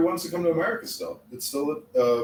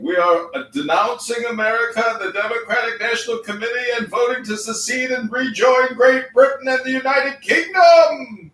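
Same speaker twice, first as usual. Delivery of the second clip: rousing rally speech; 7 semitones higher, 5 dB louder than usual. Pretend. Rallying call to secede.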